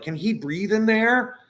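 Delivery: imitating a worried kid; kids asking. Kids asking Michael about the puppet, in a high, slow child voice. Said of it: "they were like".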